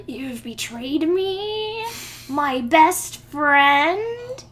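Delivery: hammed-up betrayed gasp, high and drawn out; mock-melodramatic shock, loud and stretched; dramatic betrayal. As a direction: wail it slowly, rising high on each phrase. Deku acts betrayed, dramatic gasp.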